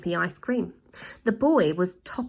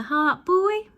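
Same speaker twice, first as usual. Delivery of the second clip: put-on light chirpy villain voice, boy much higher; chirped; 10 semitones higher, 4 dB louder than usual. Villain wife chirps at the boy, mock comforting. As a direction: chirp it out sweetly. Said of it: "chirped Missus G".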